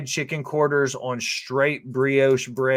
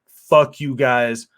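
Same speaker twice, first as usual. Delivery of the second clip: imitating friend; 7 dB louder, much slower than usual. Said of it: "He was like".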